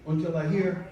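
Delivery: ordinary talk, nothing marked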